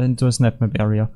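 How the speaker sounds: normal talking voice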